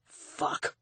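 low-volume, breath-only swear; disgusted curse. A narrator's reading. Man turning away from his victim, disgusted. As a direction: let the curse out under your breath in disgust. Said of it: "his tone was filled with disgust".

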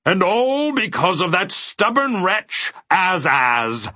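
high, drawn-out roar of rage; enraged character outburst, slow and loud; furious roar. A narrator's reading. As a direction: cut in, roaring with fury. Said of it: "roared the mathemagician".

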